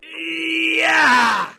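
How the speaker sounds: loud, high battle yell; boy's cry of triumph mid-attack; triumphant cry. A narrator's reading. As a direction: shout it as you smash the rock. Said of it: "cried Geelong".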